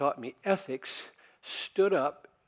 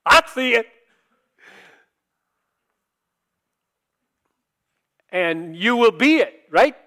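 loud, raised preacher's cry; full-force preaching; 5 semitones higher, 12 dB louder than usual. Acted. Preacher driving home that death comes daily, loud. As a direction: hammer the point home to the congregation.

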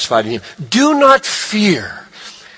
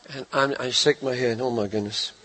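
much softer, low dismayed mutter; exasperated sigh; 7 semitones lower, 12 dB quieter than usual. Real, not acted. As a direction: shake your head, barely voicing it. Dismayed head shake.

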